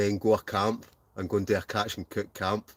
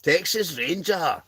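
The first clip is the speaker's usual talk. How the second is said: put-on voice; 8 semitones higher, 3 dB louder, slower than usual. Reads the name in a drawn-out voice.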